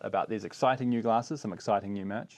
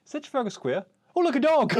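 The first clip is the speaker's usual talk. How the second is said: high, loud airhead chirp; a dopey tourist spotting a dog; high giddy squeal; ditzy distraction; 5 dB louder than usual. Pretend. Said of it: "texting him stream of consciousness".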